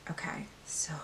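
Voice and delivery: breathless little whisper. Real, not acted. To her viewers, an awed whisper. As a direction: whisper it like a secret. Whispering over makeup haul.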